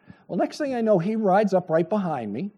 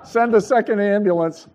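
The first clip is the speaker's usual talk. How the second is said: loud, laughing pretend dispatch call; laughing mock radio call; 6 semitones higher, 8 dB louder than usual. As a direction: joke calling for backup, cracking up. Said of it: "felt like going".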